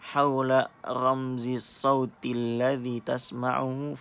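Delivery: careful, drawn-out recitation for students to follow; slow clear reading. Shown as a demonstration. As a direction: read the Arabic instruction slowly and clearly, word by word. (bacakan perlahan dan jelas)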